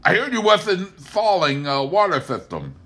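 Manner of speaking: wheedling neighbor voice; Pa fishing for favors at the back door; Pa Kettle voice. A narrator's reading.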